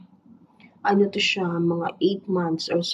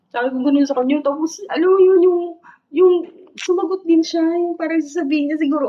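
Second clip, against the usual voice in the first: normal talking voice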